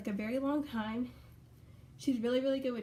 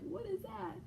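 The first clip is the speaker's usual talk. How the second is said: higher, softer puzzled dog voice; voicing the puppy; 7 semitones higher, 5 dB quieter, slower than usual. Puppy staring into the camera, voiced high and soft. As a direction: play the curious puppy, light and small. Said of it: "She's like".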